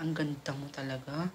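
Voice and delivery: a hushed, low imitation of a suitor's compliment; soft compliment. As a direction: murmur it sweetly like a suitor. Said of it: "kinukompliment niya ako palagi".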